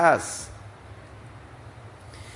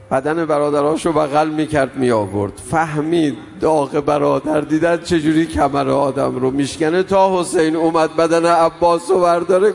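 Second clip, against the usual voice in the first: louder, driving mourning sermon climax; preacher swelling into loud lament; rising rawza lament; 3 semitones higher, 5 dB louder than usual. Acted. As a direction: build the grief in full voice.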